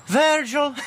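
high-pitched joke Scottish voice; Scottish accent. Acted. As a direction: call out as a hammed-up Scottish woman. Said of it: "he does it in a Scottish accent".

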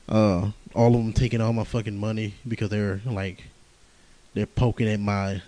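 ordinary talk

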